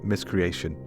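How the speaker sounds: usual speaking voice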